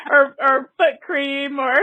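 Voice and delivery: talking through laughter; real giggles breaking into the words; laughing. Real, not acted. Joking about ridiculous ads, laughing.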